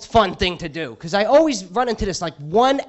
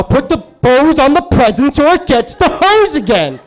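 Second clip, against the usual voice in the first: slow, louder menacing captor; louder, slow villain threat; creepy captor; 5 semitones higher, 8 dB louder, slower than usual. Retold or imitated. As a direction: threaten him like the Silence of the Lambs killer. Silence of the Lambs parody.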